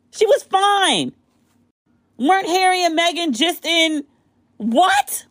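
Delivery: high, drawn-out disbelief; can't believe it, rising what; stunned. Real, not acted. Reacting to breaking news, stunned and high.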